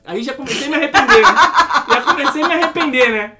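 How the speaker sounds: laughing regret. Real, not acted. Worker laughing at his regret over calling the police. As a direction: laugh at your own mistake.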